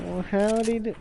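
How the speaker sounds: half-hearted try at a southern US accent; attempted southern accent. Shown as a demonstration. Trying a southern accent on request, badly faked southern accent. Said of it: "can you do a southern accent?".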